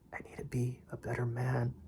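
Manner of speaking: a tearful plea, barely voiced; broken down whisper. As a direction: whisper it as if breaking down. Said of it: "you can hear my voice crack".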